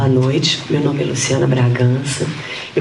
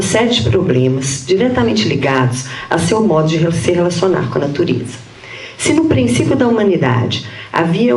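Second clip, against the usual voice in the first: higher-pitched, measured read-aloud voice; formal paper reading; 6 semitones higher than usual. Real, not acted. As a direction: read out a prepared academic text. Reads her prepared paper aloud. (leitura formal de texto acadêmico)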